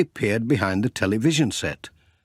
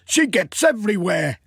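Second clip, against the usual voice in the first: higher, fond grumble of a shopkeeper; Sam amused; 10 semitones higher than usual. Acted. Sam remarking on Katie to Pat, higher wry voice.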